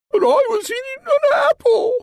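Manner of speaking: very high, tearful protest with a laughing sob; crying queen. Acted. Queen crying back at the sleepy magician. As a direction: wail it like a hurt queen. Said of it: "cried the queen".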